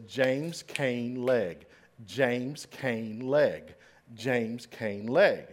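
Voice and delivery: slow, measured chant of the code words; slow spoken code. Shown as a demonstration. Teaching the walking code, slow chant. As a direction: spell out each word of the rhythm slowly. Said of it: "And they had a code".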